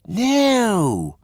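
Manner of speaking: very high, bright schoolboy chatter; eager kid talk; high boyish voices. Acted. Boys talking about the trip.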